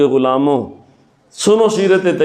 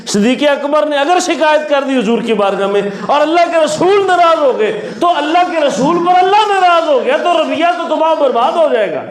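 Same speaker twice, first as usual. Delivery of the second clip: fervent preaching; 6 semitones higher than usual. Acted. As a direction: drive the warning home with rising force. Preacher builds to climax. (پرجوش خطابت، اونچی اور بلند آواز)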